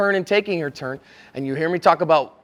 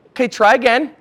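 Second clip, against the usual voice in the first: higher, louder prompt to retry; puzzled and raised in pitch; baffled prompt; 6 semitones higher, 5 dB louder than usual. Acted. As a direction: coax a garbled speaker to have another go. Reacting to someone's garbled speech.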